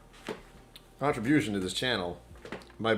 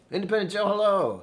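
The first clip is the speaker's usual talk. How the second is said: much higher, loud sing-song hello; excited greeting; 12 semitones higher, 8 dB louder than usual. Real, not acted. Streamer greets viewer Independent Joe, high and excited. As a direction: greet a regular with a bright high call.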